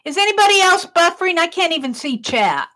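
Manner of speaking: usual speaking voice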